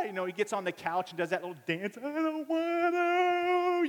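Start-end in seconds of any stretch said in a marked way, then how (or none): 2.0-3.9 long, high, loud mock wail; drawn-out five-year-old's howl; protesting child. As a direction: imitate a tantrum, stretching the words right out. Boy protests, dancing on the couch.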